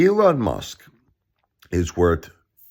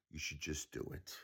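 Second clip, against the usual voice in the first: very soft, lower aside; hushed repeat; 4 semitones lower, 25 dB quieter than usual. Real, not acted. Repeating the demand to the billionaire after shouting, very quiet. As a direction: drop it right down, almost under the breath.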